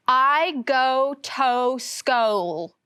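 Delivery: "I go to school" with every O said long oh: "I go toe schole"; playful long-O reading; all O's as long O. Shown as a demonstration. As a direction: give every O a long oh, slowly. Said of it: "reading it with the long O sound, like in go".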